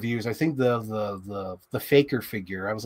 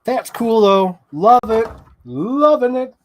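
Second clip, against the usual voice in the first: excited; 8 semitones higher, 7 dB louder than usual. Real, not acted. Gushing over toy figure.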